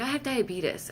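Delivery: high, urgent plea; urgent explanation. Retold or imitated. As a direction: blurt it out to make them understand. Retold explanation to cops.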